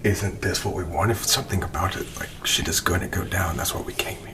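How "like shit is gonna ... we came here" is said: hushed whisper. Real, not acted. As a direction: whisper so nothing hears. Whispering on camera in cellar.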